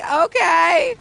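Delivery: stunned okay. Retold or imitated. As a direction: let the okay jump up in disbelief. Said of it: "I was like".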